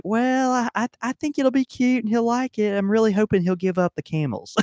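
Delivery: high, sweet mock wife voice; hopeful wife. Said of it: "these wives will come in and be like".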